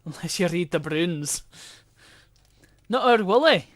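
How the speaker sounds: put-on terrible Scottish accent. Streamer naming old Scottish comics to a viewer, mock Scottish accent. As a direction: do a badly faked Scottish accent. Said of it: "I do my terrible Scottish accent".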